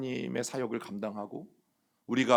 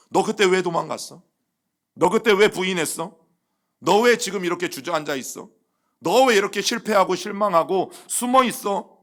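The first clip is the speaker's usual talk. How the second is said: higher and louder, hammering accusing questions; scolding rebuke; 6 semitones higher, 8 dB louder than usual. Acted. The Lord as he never spoke, scolding Peter for running away. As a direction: berate a failed disciple.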